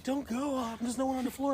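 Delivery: high-voiced imitation of a desperate coworker; mock pleading. Friend on toilet begs coworker to stay, mock pleading. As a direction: beg him to stay, hammed-up.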